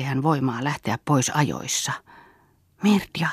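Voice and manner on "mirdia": a soft, whispered name; softly. A narrator's reading. Bengt softly whispers Mirdia's name. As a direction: breathe her name tenderly. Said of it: "jatkoi Bengt pehmeästi".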